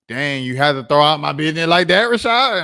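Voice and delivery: imitating a hurt friend. Friend complaining to Rashad about his stream, mock outraged. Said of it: "they text me like".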